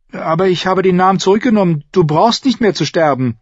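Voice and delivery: put-on high, rushed girl's protest; protesting young girl. A narrator's reading. Girl arguing that he needn't die, high and urgent. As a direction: plead urgently in a high, quick voice.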